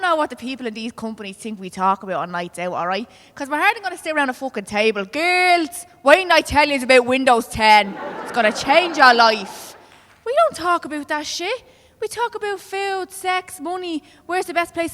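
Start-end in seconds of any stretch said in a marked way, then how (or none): 5.1-9.8 loud, hammed-up excitement to the girls; mock excited announcement. Comedian mocks girls' night chat about software, hammed-up excitement. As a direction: sell it loudly, slowing on the last line.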